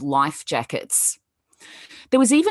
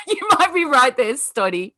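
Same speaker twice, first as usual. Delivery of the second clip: laughing; 7 semitones higher, 5 dB louder than usual. Real, not acted. Laughing reply to kraken joke.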